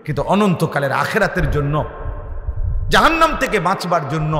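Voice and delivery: high, forceful sermon with surging peaks; impassioned preaching. Real, not acted. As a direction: preach it hard, rising to loud cries, then dropping back. (আবেগভরা জোরালো ওয়াজের কণ্ঠ)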